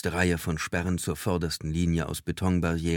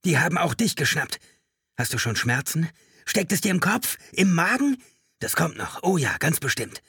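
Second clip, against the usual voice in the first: high, frantic paranoid rant; agitated high-pitched raving; high, trembling voice; 11 semitones higher, 5 dB louder than usual. A narrator's reading. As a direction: press the questions in mounting panic. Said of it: "mit hoher, bebender Stimme".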